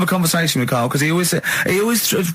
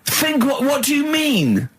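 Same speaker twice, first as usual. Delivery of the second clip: exasperated; 8 semitones higher, slower than usual. Real, not acted. Exasperated at Carl again.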